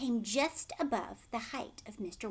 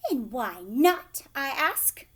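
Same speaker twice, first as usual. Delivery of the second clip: loud, slow challenge; shouted; 2 semitones higher, 9 dB louder, slower than usual. A narrator's reading. Mother shouting back at Wonka, slow and loud. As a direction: shout it back at him, demanding. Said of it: "shouted Missus Gloop".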